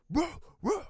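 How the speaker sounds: imitating a barking dog; high mock woof.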